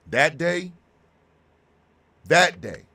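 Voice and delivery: loud, pleading emphasis; emphatic cry. Real, not acted. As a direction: hammer home the day he dreads. Stressing the hardest day.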